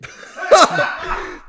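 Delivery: squealing high laugh breaking up; helpless high giggle; high burst of laughter. Real, not acted. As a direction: crack up at your own joke. Laughs at own seaweed line.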